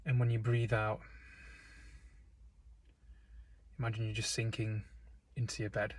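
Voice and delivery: calm, unhurried meditation voice with long pauses; guided meditation. Real, not acted. Guide leading listeners into breath and stillness, calm meditation delivery. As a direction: guide them gently, leaving space between phrases.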